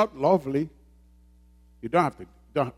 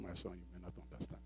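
soft fatherly aside; 10 semitones lower, 25 dB quieter, faster than usual. Retold or imitated. Father's gentle words retold.

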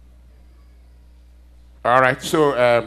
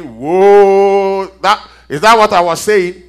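long drawn-out, louder whoa; retold amazement; 2 semitones higher, 10 dB louder, slower than usual. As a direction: act out being stunned by your own words. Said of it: "I'm going".

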